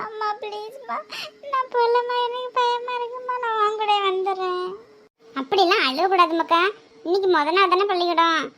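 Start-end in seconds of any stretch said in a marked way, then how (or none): 0.1-4.7 put-on high, soft whimpering child voice, slowing at the end; pleading little boy. Little boy begging his mother at the school door, high whimper. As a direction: beg mommy not to go, voice small and high.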